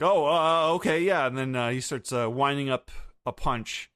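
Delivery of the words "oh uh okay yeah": startled Luffy. Retold or imitated. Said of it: "he's like".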